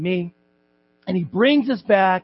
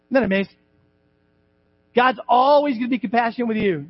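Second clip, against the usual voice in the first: ordinary talk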